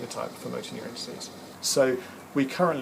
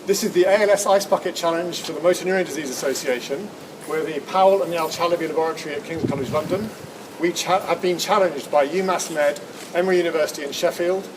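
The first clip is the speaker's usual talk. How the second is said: loud, raised announcer voice; formal announcement; 5 semitones higher, 6 dB louder than usual. Acted. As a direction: proclaim it to the camera. Announces the Ice Bucket Challenge.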